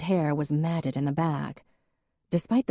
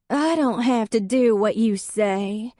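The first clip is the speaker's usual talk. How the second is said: slow, high, slurred refusal; slurred defiance; 6 semitones higher, 4 dB louder than usual. A narrator's reading. Drunk Hadley refusing Em's invitation, slow and slurred. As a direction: drag the words like a sulky drunk. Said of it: "Hadley slurred".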